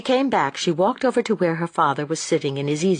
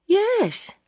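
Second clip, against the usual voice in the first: wondering. A narrator's reading. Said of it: "said Daisy a little wonderingly".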